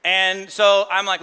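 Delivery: ordinary talk, nothing marked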